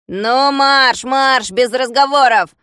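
loud, slow drill-sergeant order; commanding. A narrator's reading. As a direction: order him to march, no arguing. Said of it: "скомандовал он".

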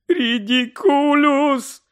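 high, very slow, loud sobbing cry; crying shout. Acted. Mother shouting the spell through tears, sobbing. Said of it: "вигукувала вона крізь сльози".

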